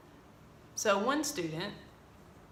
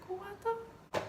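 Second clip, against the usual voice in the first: much higher but softer disbelief; stunned what the; 12 semitones higher, 7 dB quieter than usual. Retold or imitated. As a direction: caught completely off guard. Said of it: "And I'm just like".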